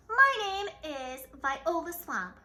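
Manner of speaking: slow, loud scratchy menace; scratchy witch voice. A narrator's reading. Stern substitute introducing herself to class, scratchy and slow. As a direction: rasp out a threatening introduction. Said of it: "said the lady in a scratchy voice".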